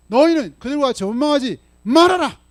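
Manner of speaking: high, loud preaching command; shouted warning to the congregation; forceful command. Acted. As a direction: drive the warning home.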